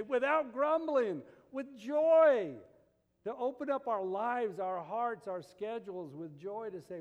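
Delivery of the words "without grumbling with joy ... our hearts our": loud, high, drawn-out proclamation; preacher's ringing declaration; proclaimed loudly. Acted. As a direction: call it out to the congregation slowly. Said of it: "you wanna say".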